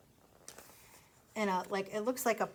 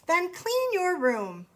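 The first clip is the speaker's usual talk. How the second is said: slow, higher stern parent voice, hammed up for kids; mock stern parent; 8 semitones higher, 4 dB louder, slower than usual. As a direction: play the bossy parent telling him off, slowly. Parent scolding in the story.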